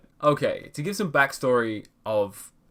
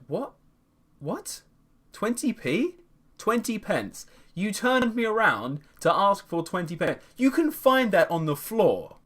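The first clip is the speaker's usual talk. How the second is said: higher, incredulous inner voice; can't believe he asked for twenty p; disbelief; 8 semitones higher than usual. Retold or imitated. Inner reaction to the stranger asking for twenty pence, incredulous.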